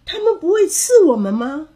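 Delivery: high, worried question; alarmed cry. A narrator's reading. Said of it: "山姆叫道". (担心地高声叫问)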